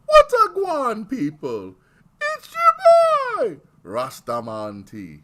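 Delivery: high, loud, slow mock Jamaican patois; hammed-up fake Jamaican greeting, high and loud; fake Jamaican accent. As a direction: ham up a fake Jamaican accent, drawn out and booming. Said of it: "wanna have the fake accents".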